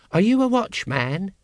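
put-on high child's voice asking; small child. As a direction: pipe up like a curious little child. Small child questions Vimes.

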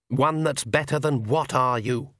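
ordinary delivery, nothing marked

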